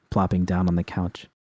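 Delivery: ordinary delivery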